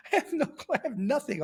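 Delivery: high, laughing retold dismay; amused mock panic, breaking into laughter. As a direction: laugh through the words. Laughs retelling his lost clothes.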